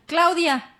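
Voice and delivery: loud, high retold radio call; imitated call for a coworker. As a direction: call the name out to summon someone. Being called over the radio.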